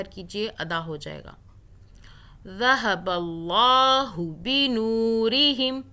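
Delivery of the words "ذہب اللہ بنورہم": ذہب اللہ بنورہم chanted slowly, نو drawn one count; Quran recitation. Shown as a demonstration. Teacher recites verse for students, slow chant. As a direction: recite slowly, don't stretch ہو. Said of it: "ہو کو نہیں کھینچنا".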